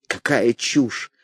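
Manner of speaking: low, slow dramatic dismissal. A narrator's reading. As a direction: say it with weighty drama. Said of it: "мой голос звучал очень драматично". (драматично, медленно и низко)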